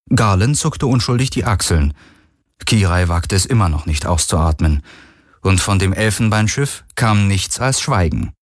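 ordinary delivery, nothing marked

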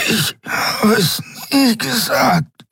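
hoch, langsam und erstickt; dünn wie Nebel. A narrator's reading. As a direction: hauch es mit letzter Luft. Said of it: "Seine Stimme war dünn wie Nebel". (nebeldünne, gepresste Stimme)

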